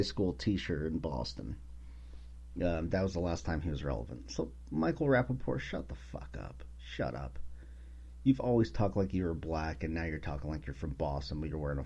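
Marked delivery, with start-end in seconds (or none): 5.6-7.4 whispered, much softer shut-up; hushed telling-off. Real, not acted. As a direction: hiss it under your breath. Telling off Michael Rappaport.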